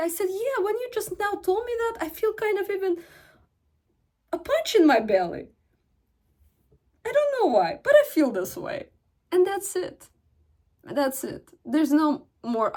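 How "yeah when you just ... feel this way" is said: much higher, sweetly hurt confession; feminine energy voice. Shown as a demonstration. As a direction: play the wounded sweet wife. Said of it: "I go into my feminine energy".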